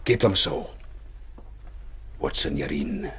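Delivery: ordinary talk, nothing marked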